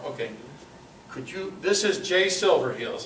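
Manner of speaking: ordinary talk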